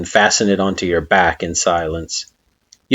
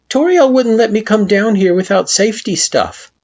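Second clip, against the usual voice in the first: put-on higher child voice explaining; sheepish Frisk; 13 semitones higher, 4 dB louder than usual. A narrator's reading. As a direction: explain a bit embarrassed.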